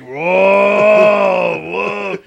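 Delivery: long, shouted biker whoa; loud drawn-out whoa. Retold or imitated. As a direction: imitate a rider's booming "whoa". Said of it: "he's like".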